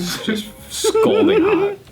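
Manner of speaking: laughing, high and slow; drawn out through a laugh. Real, not acted. Joking about sexy American accent.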